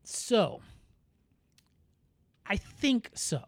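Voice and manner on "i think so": ordinary talk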